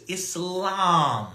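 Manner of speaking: Islam slow, vowel like an O; correct pronunciation. Shown as a demonstration. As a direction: draw out Islam with an O sound. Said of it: "it sounds more like an O".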